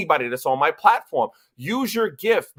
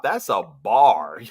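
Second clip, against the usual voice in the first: slow and low; 9 semitones lower, much slower than usual. Real, not acted. Savouring the punchline.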